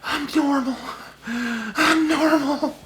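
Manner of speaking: very high, drawn-out mock wail; mock crying. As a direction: wail it like a crybaby, stretched slow. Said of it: "They're literally crying their eyes out".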